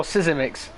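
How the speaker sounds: Sisimics in three syllables, opening si-zi; alternative pronunciation. Shown as a demonstration. Commentator guessing a driver's name, Sisimics said in three syllables. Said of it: "or Zimix or Sisimics?".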